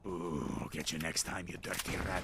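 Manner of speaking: low, soft villain voice; playful menace, way down low; mock villain threat. Mock threat to escaped rat.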